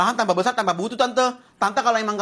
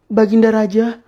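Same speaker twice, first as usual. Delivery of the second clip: mock courtly plea; 4 dB louder than usual. Mock-formal pleading to king.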